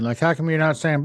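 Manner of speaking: ordinary delivery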